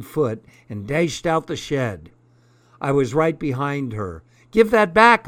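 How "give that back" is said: high, loud yell; hollering. Acted. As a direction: holler it after her. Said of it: "I hollered".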